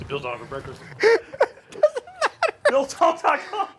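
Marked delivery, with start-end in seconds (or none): 0.9-3.8 high, giggling sign-off; laughing. Real, not acted. Host fumbling the show name, laughing.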